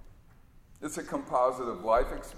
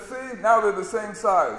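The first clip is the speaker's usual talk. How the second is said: higher, louder mock patience; hammed-up fed-up con man; exasperated straight man; 6 semitones higher, 6 dB louder than usual. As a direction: pretend the bars are equal. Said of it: "he says".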